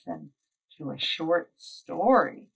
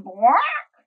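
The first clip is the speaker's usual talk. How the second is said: quack; 9 semitones higher, 8 dB louder than usual. Acted. Duck noise in story.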